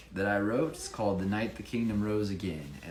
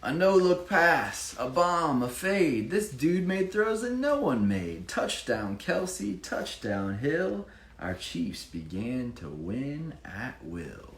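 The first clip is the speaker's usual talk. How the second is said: lively rhyming read-aloud; bouncy storybook reading voice; story narration; 6 semitones higher, 4 dB louder, slower than usual. Acted. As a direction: read it like a picture book for children. Reads rhyming Chiefs picture book.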